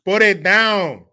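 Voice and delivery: mock shouted demand. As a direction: belt it out as a hammed-up command. Mock demands to lower prices.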